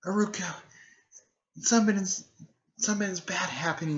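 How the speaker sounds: high, halting upset voice; starting to cry. Retold or imitated. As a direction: imitate a girl on the verge of tears. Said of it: "Naruto's starting to cry".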